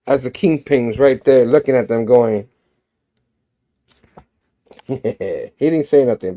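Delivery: usual speaking voice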